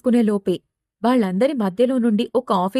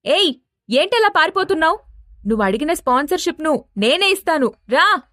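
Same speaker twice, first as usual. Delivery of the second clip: high-pitched acted shout; raised, high voice with a challenging yell; calling out loudly; 6 semitones higher than usual. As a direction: call after someone running away. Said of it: "పెద్దగా అరుస్తూ".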